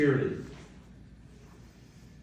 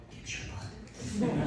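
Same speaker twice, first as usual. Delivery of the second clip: laughing; 7 semitones higher, 8 dB quieter, much slower than usual. Real, not acted. Teasing joke at memorial.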